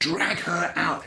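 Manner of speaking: urgent order. Retold or imitated. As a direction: hiss the order to get her out fast. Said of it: "He says".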